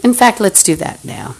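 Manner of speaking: ordinary delivery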